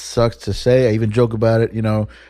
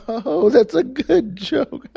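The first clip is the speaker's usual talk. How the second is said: much higher sarcastic "good joke" with a strained chuckle; sarcastic forced laugh; 9 semitones higher than usual. Retold or imitated. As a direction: laugh it off through clenched annoyance. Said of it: "I was so mad, but I was just like".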